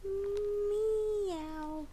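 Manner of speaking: high playful cat meow. Pretend. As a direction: meow like a kitty for the toddler. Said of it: "The kitty cat says".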